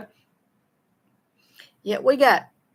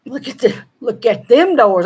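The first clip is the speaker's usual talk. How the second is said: Southern dialect phrase; 3 semitones higher, 8 dB louder than usual. Shown as a demonstration. Vlogger showing her Southern grammar to viewers. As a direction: say them doors the Southern way. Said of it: "I usually say them".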